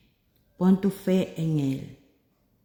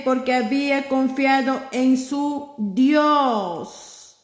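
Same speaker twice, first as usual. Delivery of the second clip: raised, lively Bible reading; preacher reading scripture aloud, higher; dramatic scripture reading; 6 semitones higher than usual. Acted. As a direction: read the story out to the congregation. Reads the Bible story aloud.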